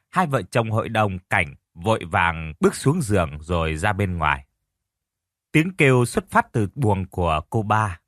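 ordinary delivery

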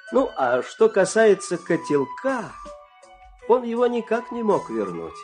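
ordinary delivery

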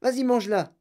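high, insistent cry; eager high-pitched command; excited urging. A narrator's reading. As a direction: egg the boy on, pitch well up. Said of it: "cria monsieur Wonka".

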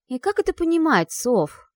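high, loud startled splutter; choking in surprise. Acted. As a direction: splutter it out in shock. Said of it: "поперхнулась я".

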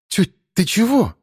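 taken aback, asking sharply; surprised. Acted. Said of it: "изумилась Вася".

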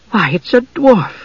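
high, drawn-out relief; slow, high-voiced pleasant realisation; relieved. A narrator's reading. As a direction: let relief lift the voice. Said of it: "said Usha, relieved".

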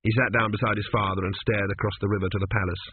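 ordinary delivery

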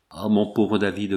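usual speaking voice

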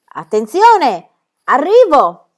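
loud, high call before the jump; shouted warning. A narrator's reading. Pippi warning the others before diving, loud and high. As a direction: yell it out as you leap.

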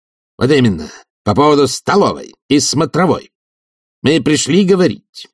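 high, insistent official demand; officious committee head. Acted. Committee head asks professor to give up rooms, high and officious. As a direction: deliver the resolution in a high, emphatic voice.

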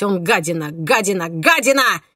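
building to a hysterical shout; rising, frantic, losing control. Acted. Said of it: "истерично закричал убийца".

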